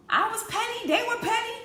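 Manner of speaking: high and loud, half laughing; amused admission. Real, not acted. Coach admitting her pettiness to viewers, high amused voice. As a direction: own it with a grin.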